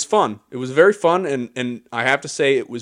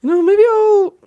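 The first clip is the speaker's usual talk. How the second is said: mock high voice; 12 dB louder than usual. Mock voice of imagined gambler.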